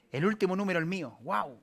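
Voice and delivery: mock excited shopper; delighted shopper. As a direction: squeal over the last pair. Finding her shoe size.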